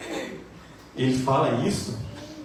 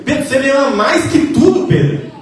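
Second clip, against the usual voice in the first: high, loud pleading question; Jesus pressing Peter, loud and high; impassioned plea; 10 semitones higher, 8 dB louder than usual. Acted. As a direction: cry it out to Peter, begging an answer. Preacher voices Jesus asking Peter.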